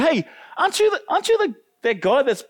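high, prying call of a stranger; accusing bystander. Retold or imitated. People confronting Peter, a mock prying high voice. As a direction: point him out, hey you.

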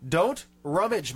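ordinary talk

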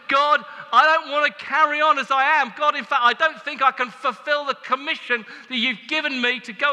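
loud, high pleading prayer; heart's cry prayer. Retold or imitated. Believer crying out to God for the Spirit, loud pleading prayer.

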